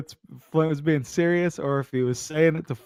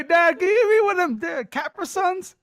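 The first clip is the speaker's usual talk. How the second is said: put-on very high childish voice asking Dad for Capra Suns; child asking his dad, high; pretend kid. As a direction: ask like a little kid.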